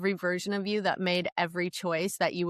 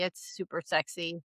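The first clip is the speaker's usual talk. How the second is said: dry sarcastic aside; 3 semitones lower, 7 dB quieter than usual. Real, not acted. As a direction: say it deadpan, meaning the opposite. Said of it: "sarcastic remark on night sweats".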